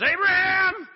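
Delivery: high, loud calling shout; voice from heaven. Acted. God calling Abraham from heaven, loud shout. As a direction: call his name from above. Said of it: "a voice from heaven says".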